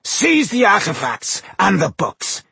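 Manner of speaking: slow, high-pitched villain's roar; commanding, drawn-out bellow; booming command. A narrator's reading. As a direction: boom the order out slowly. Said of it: "he boomed through the darkness".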